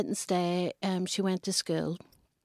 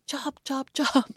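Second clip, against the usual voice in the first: put-on playful child; 7 semitones higher, 3 dB louder, slower than usual. Son playing with stick.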